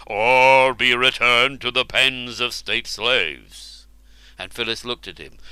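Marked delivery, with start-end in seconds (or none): 0.1-3.9 slow, heavy ruler's threat; a hint of a stern king. Ubar warning the captive woman, slow and grave. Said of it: "Marlenus threatening the slave woman".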